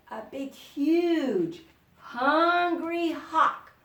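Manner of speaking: slow menacing emphasis; dramatic build-up. A narrator's reading. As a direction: stretch out big, huge, hungry. Storyteller building up the hawk.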